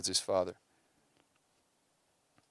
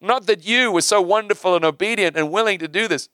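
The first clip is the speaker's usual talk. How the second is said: higher, louder voice of God, proclaiming; God speaking, raised and emphatic; 8 semitones higher, 5 dB louder than usual. Acted. God telling Abraham his plan, in a high, loud proclaiming voice. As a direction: boom it out as God declaring his purpose. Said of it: "And God says".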